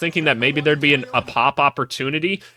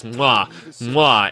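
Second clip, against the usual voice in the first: smacking "mwah" kiss sound; chef's kiss noise; 5 semitones lower than usual. Real, not acted. Reactor savors a great play, chef's kiss noise. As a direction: blow two savoring kisses aloud.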